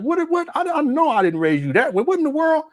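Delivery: mock-shocked parent at the kids' antics; high pitched parental disbelief; disbelieving parent.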